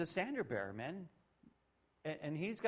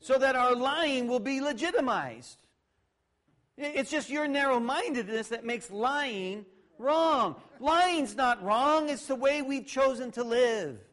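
slow, loud, higher mock self-righteous voice, dripping sarcasm; preacher parodies liars demanding rights, in a slow loud sneering mock voice; 8 semitones higher, 7 dB louder, slower than usual. Preacher mocks sinners who legitimize lying, higher and louder in sarcasm.

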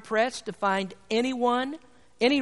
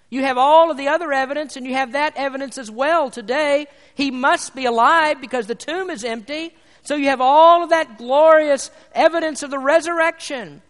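much louder, higher triumphant pulpit cry; exultant preaching; 5 semitones higher, 9 dB louder than usual. Acted. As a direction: proclaim the glorious evidence. Preaching at full force.

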